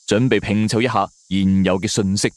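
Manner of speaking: plain reading voice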